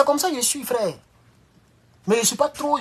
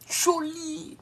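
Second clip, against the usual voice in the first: admiring; 10 semitones higher, 8 dB quieter than usual. Retold or imitated. Admiring a pretty girl.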